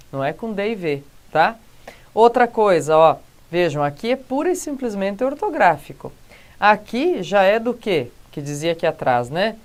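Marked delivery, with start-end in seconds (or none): none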